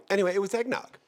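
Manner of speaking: high-pitched gleeful reveal; bright burst on eggnog; high excited reveal. Real, not acted. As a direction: pop up high, announcing the twist. Comic reveal after prank fantasy.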